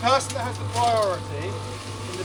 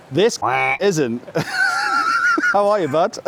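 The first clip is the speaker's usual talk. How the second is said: ordinary talk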